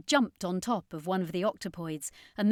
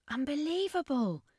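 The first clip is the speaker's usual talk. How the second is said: put-on higher, amazed young woman; Danica's voice; 7 semitones higher than usual. A narrator's reading. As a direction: gasp it out in wonder. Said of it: "Danica said".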